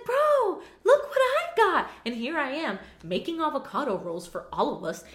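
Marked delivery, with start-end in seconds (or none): 0.0-1.9 high, bright-eyed bragging; squealing about a new planner; excited friend mimic. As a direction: show off the new toy, way up high. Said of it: "she's like".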